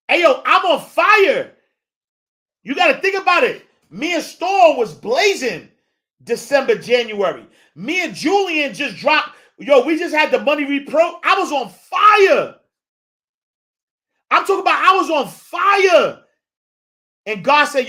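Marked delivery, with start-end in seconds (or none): 0.1-1.5 high, loud brag; triumphant "on fire" cry; hyped boast. Real, not acted. 11.2-16.2 preacher's fired-up declaration; shouting emphasis. Real, not acted.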